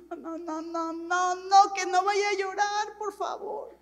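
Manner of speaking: hammed-up reluctant plea; mock dread. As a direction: beg as if dreading someone's tears. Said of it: "llegaban las hermanitas llorando y yo decía".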